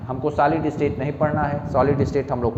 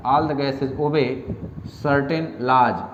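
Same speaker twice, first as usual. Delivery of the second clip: very slow, words spaced for writing; slow dictation pace; much slower than usual. Shown as a demonstration. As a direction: dictate each word deliberately. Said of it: "लिख लीजिए".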